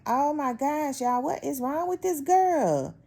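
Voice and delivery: high, drawn-out disbelief; exasperated. Retold or imitated. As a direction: pitch way up, stretch it out in disbelief. Exasperated at coworker sitting close.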